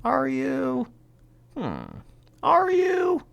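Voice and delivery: high-pitched sceptical "are you?"; mock doubting. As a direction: question it in a high, doubting squeak. Sceptical retort to the victim claim.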